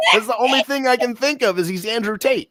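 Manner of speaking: high-pitched, cracking up while talking; laughing. Real, not acted. Defending the wrestler comparison to co-host, laughing. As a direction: push the words out through real laughter.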